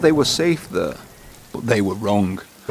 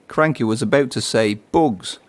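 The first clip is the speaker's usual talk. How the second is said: ordinary delivery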